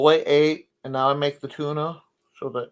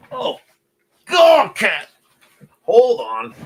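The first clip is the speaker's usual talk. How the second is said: high, loud yelp at the cat; startled shout; 11 semitones higher, 9 dB louder, much slower than usual. Real, not acted. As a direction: jump and yell at the cat.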